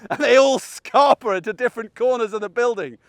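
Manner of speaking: ordinary delivery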